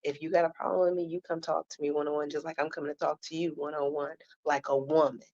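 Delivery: imitating a hushed word in private; discreet private aside. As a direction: say it fast and softly, as if pulled aside one-on-one. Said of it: "pull her to the side and say".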